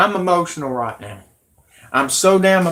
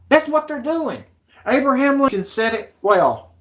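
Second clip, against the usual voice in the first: angry rant; 8 semitones higher than usual. Real, not acted. Streamer raging at politicians and Hollywood, much higher and heated. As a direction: spit out the insults as the anger climbs.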